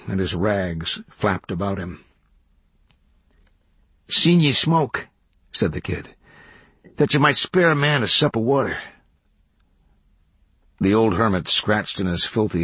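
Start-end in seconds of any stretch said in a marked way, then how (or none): 4.1-5.1 higher, drawn-out country greeting; raised backwoods character voice; drifter kid hailing. A narrator's reading. Kid greets hermit at his door. 7.0-8.8 higher-pitched rustic request; raised country character voice; drifter kid asking. A narrator's reading. Kid asks hermit for water.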